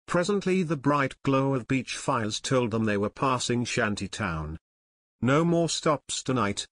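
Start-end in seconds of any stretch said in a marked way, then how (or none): none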